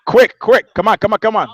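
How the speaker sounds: loud, high, impatient hurry-up; urging. Real, not acted. Hurrying the person he called.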